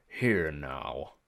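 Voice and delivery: low British challenge; suspicious British ferryman; British-like accent. A narrator's reading. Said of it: "He had a strange accent. British maybe".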